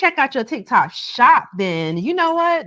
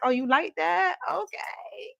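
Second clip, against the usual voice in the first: playful, higher cosmic voice answering you; the universe talking; 8 semitones higher than usual. Pretend. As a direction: speak as a generous universe, lifted high. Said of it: "The universe be like".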